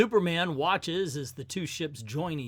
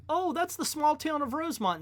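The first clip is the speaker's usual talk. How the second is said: mock alien; 12 semitones higher than usual. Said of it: "Why would an alien be like".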